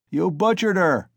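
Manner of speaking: high, loud accusing cry; shouted. A narrator's reading. Onlooker accusing Mikolka, high loud shout. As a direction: hurl the accusation at him. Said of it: "someone shouted in the crowd".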